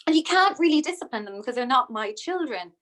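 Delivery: usual speaking voice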